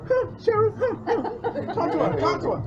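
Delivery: high, eager, fast urging; hammed-up pushing to talk; excited mum voice. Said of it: "She went".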